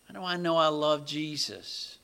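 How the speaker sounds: ordinary delivery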